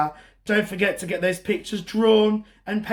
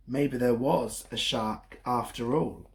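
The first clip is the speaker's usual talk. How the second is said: low, soft ominous hint; spooky hint; 6 semitones lower, 5 dB quieter than usual. A narrator's reading. Storyteller ending with a hushed spooky twist. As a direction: let it hang darkly.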